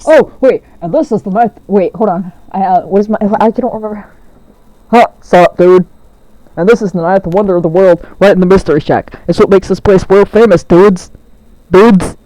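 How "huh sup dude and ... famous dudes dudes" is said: louder laid-back dude voice; a hint of a chill tour guide; surfer dude voice. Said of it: "Sue's voice right now".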